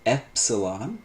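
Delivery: epsilon said clearly, short e as in "bet"; careful teacher pronunciation of epsilon; short e demo. Shown as a demonstration. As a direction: spell out epsilon for students. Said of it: "One is short".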